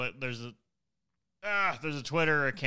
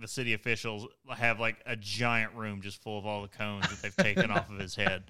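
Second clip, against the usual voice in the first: usual speaking voice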